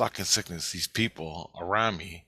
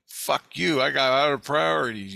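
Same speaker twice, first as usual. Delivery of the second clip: higher, louder retold retort; heated mock comeback; defiant comeback; 6 semitones higher, 5 dB louder than usual. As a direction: act out firing back at your accusers. Acted angry comeback to the yellers.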